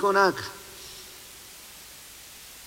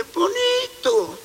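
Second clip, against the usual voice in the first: mock cooing; 11 semitones higher, much slower than usual. Describes the wolf as cute.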